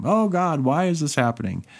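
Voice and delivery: high, pleading cry to God; mock crying out. Believer crying out to God in trouble, mock wail. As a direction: wail it up to heaven, half in jest. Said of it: "when we cry out to him".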